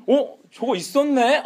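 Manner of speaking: high, loud fake surprise; feigned ignorance. Saul caught with the spared animals, feigning surprise. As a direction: pretend you only just noticed it. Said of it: "막 모른 척하면서".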